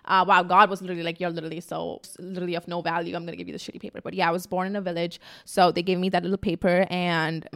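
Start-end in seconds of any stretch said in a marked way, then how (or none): 1.2-4.0 God shrugging her off, quietly; mock God voice. God brushing off the vlogger, murmured softly.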